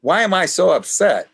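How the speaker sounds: put-on high, loud whining of an upset boy; grandson upset. Grandson after losing at bingo asks himself, high and whiny. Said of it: "he's talking to himself and he says".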